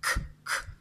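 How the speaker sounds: "k, k" as bare voiceless consonants; isolated phoneme. Shown as a demonstration. Teacher demonstrating the phoneme, "k" sounded alone. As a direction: sound "k" twice with no vowel. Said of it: "J'entends le phonème k".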